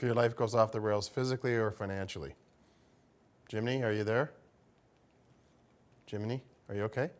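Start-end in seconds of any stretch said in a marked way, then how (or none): none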